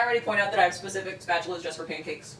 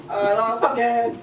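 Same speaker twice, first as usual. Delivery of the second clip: silly slow voice; 2 semitones higher, 5 dB louder, much slower than usual. Pretend.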